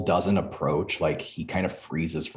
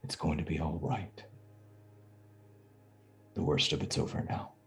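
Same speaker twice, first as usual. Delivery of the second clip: very quiet; 5 dB quieter, faster than usual. Acted. Knight to shaken companion from behind his helm, very quietly. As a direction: say it softly, with long pauses between sentences. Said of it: "just very quietly".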